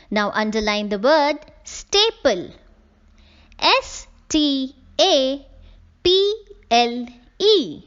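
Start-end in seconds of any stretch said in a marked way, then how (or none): 3.6-7.8 slowly, one letter at a time; spelling out. Shown as a demonstration. Teacher spelling a newly underlined word, letter by letter. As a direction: spell it for the class with gaps between letters.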